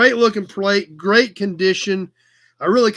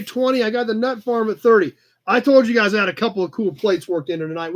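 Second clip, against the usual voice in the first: ordinary talk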